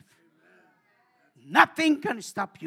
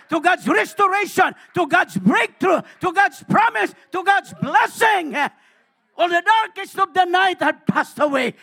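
fiery preaching; 7 semitones higher, 11 dB louder than usual. Acted. Preaching at full force.

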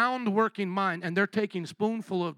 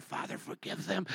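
soft, breathless last words; hushed dying words; 9 dB quieter than usual. Pretend. Churchgoer retelling the play's crucifixion, hushed. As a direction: whisper the words from the cross. Jesus quoted from cross.

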